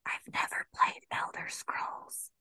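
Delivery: hushed, sheepish whisper; whispered confession. Real, not acted. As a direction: whisper it like a guilty secret.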